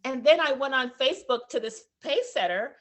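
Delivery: normal talking voice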